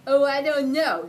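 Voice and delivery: mock terrifying. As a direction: try hard to sound frightening. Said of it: "trying to sound terrifying".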